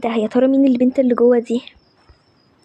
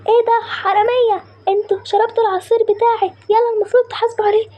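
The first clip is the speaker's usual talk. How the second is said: صياح غاضب; 7 semitones higher than usual. Acted. بائع يكتشف الحرامية.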